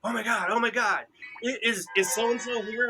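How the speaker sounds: high, breathless mock excitement; excited stranger. Retelling a lady who ran up while walking the dog, excited. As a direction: imitate a frantic lady in the park.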